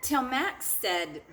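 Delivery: expressive picture-book read-aloud for a class; lively storybook narration; story reading to children. A narrator's reading. Teacher reads picture book aloud.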